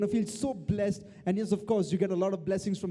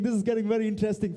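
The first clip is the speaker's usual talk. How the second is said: ordinary talk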